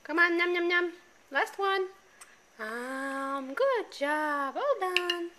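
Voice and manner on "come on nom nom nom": put-on playful chewing sounds for baby; nom nom chomping. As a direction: chomp it out to coax a bite. Coaxing baby to eat.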